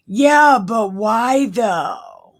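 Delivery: loud, drawn-out disbelief; incredulous questioning. Real, not acted. Reader interrupting the Bible verse to question it, incredulous. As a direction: burst in loud and high, then stretch it out.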